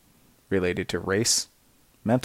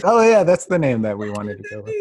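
excited; 9 semitones higher, 8 dB louder than usual. Retold or imitated. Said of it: "and then we're like".

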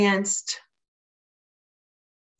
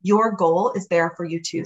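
usual speaking voice

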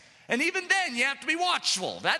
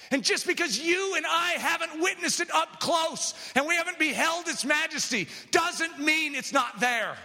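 fiery preaching; 5 semitones higher than usual. Acted. Preaches at full force.

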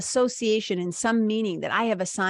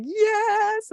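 a very high, thrilled yes; excited yes; 13 semitones higher than usual. Shown as a demonstration. Showing listeners her energy lighting up, thrilled. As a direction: burst up with giddy delight. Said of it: "my whole energy goes like".

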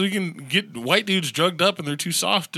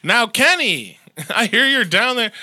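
loud, higher singsong call to a rival; mock taunting call; 8 semitones higher, 8 dB louder than usual. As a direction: call out to him like a playground taunt. Calling out mock taunt to Kenny.

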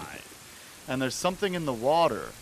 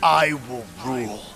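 megalomaniac rant; 3 dB louder than usual. Acted. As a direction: boast of ruling the world. Ganondorf proclaims himself.

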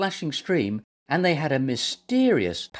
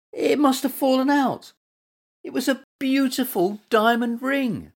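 high-pitched, upset protest; shocked dismay; 9 semitones higher than usual. A narrator's reading. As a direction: plead your case in dismay. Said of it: "he gasped".

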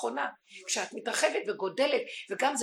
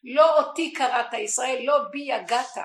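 louder, the verse declaimed; loud verse quote; 2 semitones higher, 9 dB louder than usual. Acted. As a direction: proclaim it to the congregation. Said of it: "השם אומר".

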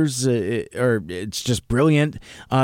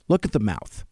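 calm and softer, unhurried; 3 semitones lower, 4 dB quieter than usual. Retold or imitated. Friend calmly points out the toy's mouth, very calm. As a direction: set it down quietly, very calmly. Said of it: "very calmly says".

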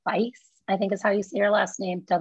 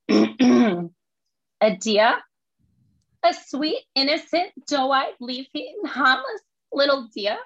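Lisa's My Cousin Vinny speech in thick Brooklyn; New York accent, pushed up and punchy; 4 semitones higher than usual. Acted. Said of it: "So it's New York accent".

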